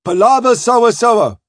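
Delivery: high, loud crewman's bawl. Acted. Crewman refusing Spring's palaver, bawling. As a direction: bawl it back in coast pidgin. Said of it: "bawls the crew".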